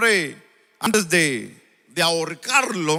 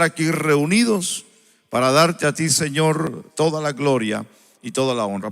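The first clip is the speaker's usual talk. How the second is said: prayer; 6 semitones lower than usual. Acted. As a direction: lead the congregation in prayer. Opening prayer before the sermon.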